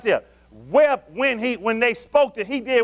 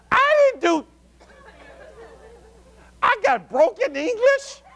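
high, loud, comic disbelief; incredulous protest; 12 semitones higher, 3 dB louder, slower than usual. Retold or imitated. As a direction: squeal it in mock bafflement. Acting out his own baffled reaction.

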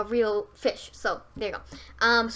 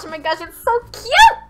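high, loud squeal of delight; gushing over something cute; 12 semitones higher, 11 dB louder than usual. Real, not acted.

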